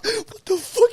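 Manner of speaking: high startled yelp, mother woken up; startled mum. Retold or imitated. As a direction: imitate the shocked mum. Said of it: "mum's like".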